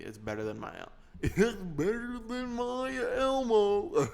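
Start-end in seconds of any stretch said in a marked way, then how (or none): 1.2-4.1 loud, slow, high cartoon guffawing voice; Goofy. Bragging it beats his Elmo, as a drawn-out Goofy. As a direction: stretch it out goofily with hiccupping chuckles. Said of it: "That's a Goofy".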